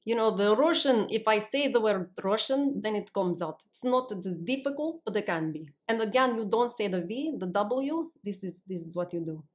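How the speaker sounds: put-on Russian accent on request; demonstrating thick Russian vowels and consonants. Shown as a demonstration. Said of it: "Can you do a Russian accent?".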